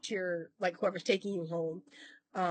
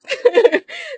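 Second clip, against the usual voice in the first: high, loud burst of laughter; sudden high cackling laugh; real laugh; 13 dB louder than usual. Real, not acted. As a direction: laugh out loud at your own joke. Laughs after own joke.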